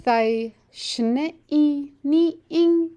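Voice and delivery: "Thai shne i ni ing" said slowly, each syllable spaced, pitch lifting on the tones; slow model sentence. Shown as a demonstration. Teacher models a Shoshone sentence for the class, slow and careful. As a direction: model it slowly for learners to copy. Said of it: "so repeat the sentence after me now".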